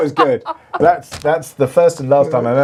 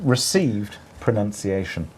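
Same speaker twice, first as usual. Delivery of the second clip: posh accent; 7 semitones lower, 7 dB quieter, slower than usual. Shown as a demonstration. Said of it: "Yeah, in my accent".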